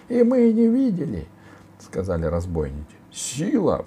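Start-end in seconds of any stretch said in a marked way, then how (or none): 0.1-1.3 very high, loud cry; robbers chiming in. A narrator's reading. Robbers backing their chief's lie, high loud shout. 3.1-3.8 high imploring call; pleading girl. A narrator's reading. Girl begging the warrior for support, pleading. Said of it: "взмолилась Алиса".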